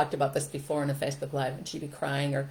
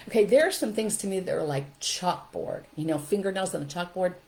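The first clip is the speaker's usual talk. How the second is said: ordinary delivery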